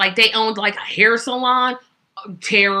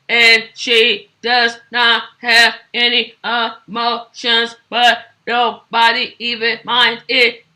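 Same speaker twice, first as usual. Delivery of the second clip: put-on robot girl voice; 2 semitones higher, 6 dB louder than usual. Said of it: "she talks like this".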